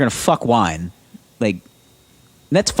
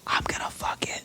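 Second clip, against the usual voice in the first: breathy whisper spoken straight into the mic; whisper demo; 12 dB quieter than usual. Shown as a demonstration. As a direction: whisper it close to the microphone. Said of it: "How'd I do it?".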